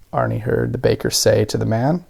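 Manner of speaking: plain reading voice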